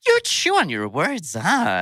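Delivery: high, swooping teasing voice; exaggerated swoops, playfully imitating someone. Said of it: "she t-, turn around and go".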